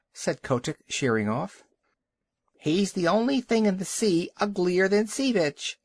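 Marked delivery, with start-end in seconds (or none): 2.6-5.8 screaming gull. Acted. Gull wheeling under the walrus's nose, jeering screech. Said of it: "screamed a burgomaster gull".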